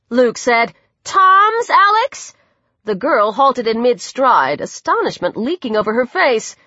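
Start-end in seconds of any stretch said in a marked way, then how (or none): 1.0-2.3 high, slow, rising disbelief; drawn-out astonished query; astonished question. A narrator's reading. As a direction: stretch it out in amazed recognition. Astonished recognition of the girl.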